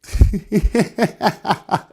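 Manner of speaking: high giggly laugh; giggle. Real, not acted. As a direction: break into giggles. Laughs, plan might work.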